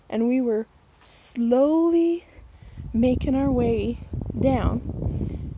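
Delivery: drawn-out, suspenseful telling; slow storyteller's creep; slowly. Retold or imitated. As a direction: stretch it out like you're creeping down the path. Creeping down toward rustling bushes.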